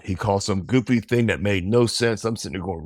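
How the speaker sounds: ordinary delivery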